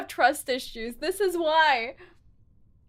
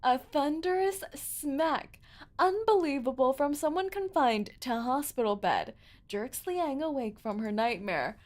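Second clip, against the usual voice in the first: soft, lowered storytelling voice; story narration; 2 semitones lower, 9 dB quieter than usual. A narrator's reading. Narrator tells of a patient jerked awake, soft narration. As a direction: narrate it softly and deliberately.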